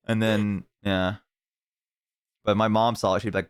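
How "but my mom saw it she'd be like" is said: normal talking voice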